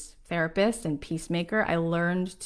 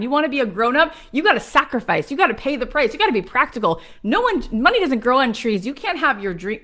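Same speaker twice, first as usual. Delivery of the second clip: mock-scolding, louder and higher as it builds; scolding naysayer; 4 semitones higher, 5 dB louder than usual. Voicing those who warn her not to leave the line, scolding. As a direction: imitate a nagging chorus of don'ts.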